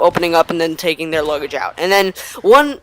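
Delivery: ordinary talk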